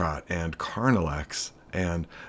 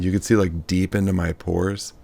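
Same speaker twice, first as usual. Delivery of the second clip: ordinary talk, nothing marked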